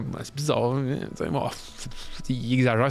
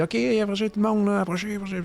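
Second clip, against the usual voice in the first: calling out; 6 semitones higher, faster than usual. Acted. Priest calling the crowd over.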